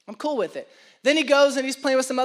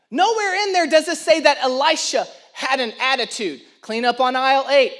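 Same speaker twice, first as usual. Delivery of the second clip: louder, building sermon delivery; preacher telling the congregation about Elisha, rising preaching; 2 semitones higher, 3 dB louder than usual. Acted. Drive the sermon with full force.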